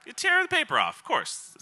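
voix qui bondit très haut sur tear, comme une évidence; mock obvious answer. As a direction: play it like the only sane answer, pitch leaping up. Rhetorical answer, obvious to him.

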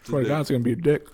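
talking while chewing; words round a bite of food; mouth full. Real, not acted. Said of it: "If you guys are gonna chew".

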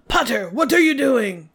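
loud, sharp teacher's telling-off; stern rebuke. A narrator's reading. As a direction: call out the pupil angrily. Snape catches Harry, read aloud.

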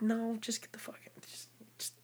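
whispered, exasperated shooing; breath-only retold brush-off; hushed dismissal. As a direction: whisper it as if waving someone away. Waving the flustered driver away.

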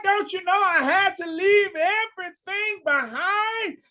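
high, pleading complaint; desperate listener's plea. Acted. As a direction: plead like one who fled everything. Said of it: "voices a refugee again".

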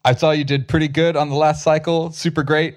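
pleading. Acted. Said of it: "I run up to Claire".

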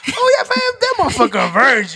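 very high mock mom voice; high-pitched hammed-up heckle; heckling mom. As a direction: imitate a mom jeering from outside. Acts somebody's mom coming out.

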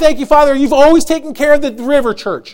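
loud, fervent thanksgiving prayer; preacher voicing a believer's bold prayer of thanks; confident prayer. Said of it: "all he does is speak what God has already told him".

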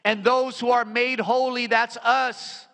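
ringing, emphatic pulpit delivery; full-force preaching. Real, not acted. Preacher reads scripture to the congregation, full-force preaching. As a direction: proclaim it with drawn-out emphasis.

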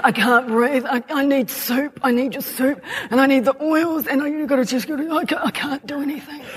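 high, pitiful man-flu whimper; whiny sick son. Retold or imitated. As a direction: play the dying teenager with man flu. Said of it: "he's lying on the floor and he goes".

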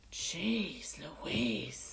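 breathy aside under effort; whispered. Real, not acted. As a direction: whisper it while wrestling the cat. Struggling with the cat.

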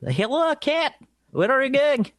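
put-on sing-song falsetto with a Russian accent; Russian-accented cat talk. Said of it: "talk to her cats like that".

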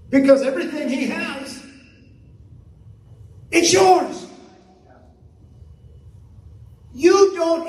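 high, building, ringing sermon climax; preaching at full force. Acted. Pastor tells the congregation everything is theirs, full preaching. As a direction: rise and proclaim it to the whole room.

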